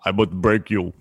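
put-on low, menacing Russian accent; deep-pitched Russian tough-guy threat. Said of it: "You have the Russian, too".

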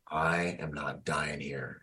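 slow, breathless whispered vow; determined whisper. Retold or imitated. A wounded soldier crawling from the wreck vows to himself, whispered. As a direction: whisper it through clenched resolve.

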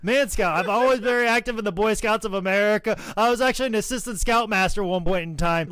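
high, fast mock-eager rattle; eager pitch. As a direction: pile on credentials to impress the boss. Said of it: "I'd be like".

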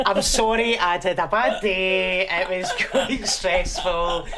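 giggly, drawn-out pretend remorse; laughing mock apology. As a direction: draw out a sheepish excuse while laughing. Joking apology amid laughter.

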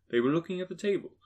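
plain reading voice

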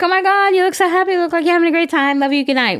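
high, fast and gushing; gushing excited. Retold or imitated. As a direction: gush over the photo like a proud mom. Mom's gushing text, retold.

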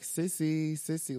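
put-on high, loud little-girl call; toddler calling. Toddler tapping window calls for her sister in a high voice.

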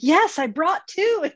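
high, drawn-out insistence; emphatic retold reply.

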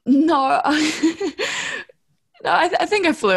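high, fast, through laughter; laughing. Real, not acted. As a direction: giggle the words out. Laughing at own answer.

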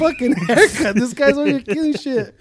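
high, loud disbelief; incredulous complaint. Retold or imitated. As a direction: protest the chaos in mock outrage. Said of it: "I'm still sitting there like".

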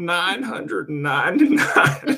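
put-on high, laughing old man host. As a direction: open the show as an old man. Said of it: "you could just use your regular voice".

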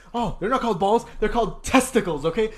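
high, loud, scolding correction; yelling old teacher. Retold or imitated. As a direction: correct a child like an outraged old lady. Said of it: "imagine an old lady yelling".

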